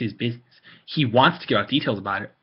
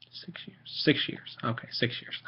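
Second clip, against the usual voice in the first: whispered counting to self; whispering; 8 dB quieter, slower than usual. Real, not acted. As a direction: mutter the sum under breath. Whispering to self doing math.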